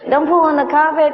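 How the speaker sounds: high, loud scolding owner voice from the parrot; parrot mimicking owner. Parrot copying the owner scolding, high and loud. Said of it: "sounds exactly like the owner's voice".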